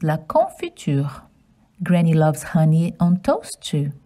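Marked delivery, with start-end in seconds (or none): 0.0-1.1 la confiture with nasal con; French pronunciation. Shown as a demonstration. Teaching children la confiture. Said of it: "Jam in French is".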